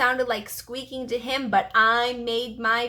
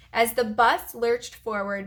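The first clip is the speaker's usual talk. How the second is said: ordinary delivery, nothing marked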